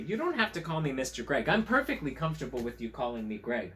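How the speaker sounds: ordinary delivery, nothing marked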